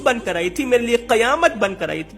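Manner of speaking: usual speaking voice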